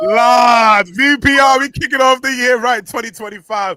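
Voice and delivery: loud, high hype call; hype shout. Real, not acted. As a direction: shout it like a radio hype man. Radio host hyping the new year.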